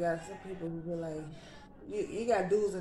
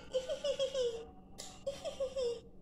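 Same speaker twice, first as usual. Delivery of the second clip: embarrassed high-pitched giggle; high giggle after a burp; 5 dB quieter than usual. Real, not acted. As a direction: giggle high and soft at your own burp. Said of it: "It's so unladylike".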